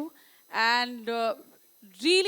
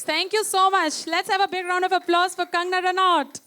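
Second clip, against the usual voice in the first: loud, raised voice calling for applause; rousing host announcement; 4 semitones higher, 5 dB louder than usual. Real, not acted. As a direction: whip the crowd up to clap. Host whips up audience applause. (ज़ोरदार, जोशीली घोषणा)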